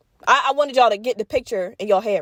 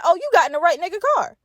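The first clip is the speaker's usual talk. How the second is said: high, bright "oh" of a proud guy; excited dealer quote; 7 semitones higher, faster than usual. Retold or imitated. Dealer bragging to the narrator, mock excited pitch up. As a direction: voice him hyped, showing off. Dealer voiced in retold story.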